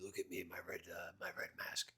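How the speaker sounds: hushed, breathy mock-villain whisper. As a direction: whisper it like a vain masked baddie. Mocking a masked villain.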